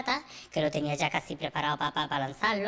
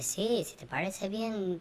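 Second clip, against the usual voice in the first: very slow, coaxing; slow persuading; 4 dB quieter, much slower than usual. Retold or imitated. Robert slowly coaxing her to agree to the show. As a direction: draw it out gently to win her over.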